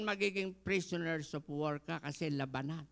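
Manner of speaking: usual speaking voice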